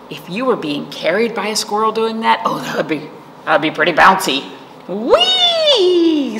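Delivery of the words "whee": high delighted squeal; gleeful whee. A narrator's reading. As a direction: squeal like on a ride. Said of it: "says Acorn".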